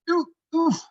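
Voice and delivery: a stuck fairy's shrill yelp; high startled oof. Acted. Both hands stuck.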